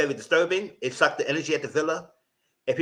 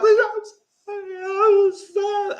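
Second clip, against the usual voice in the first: high, teary, hammed-up sorry, much higher; mock crying apology; 5 dB louder, slower than usual. Imitating the islanders' tearful apology, mock crying. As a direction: whimper it like a fake sob, slowly. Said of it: "Everybody started".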